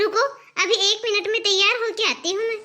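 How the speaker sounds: usual speaking voice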